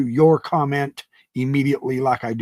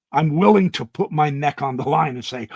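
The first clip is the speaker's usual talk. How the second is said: normal talking voice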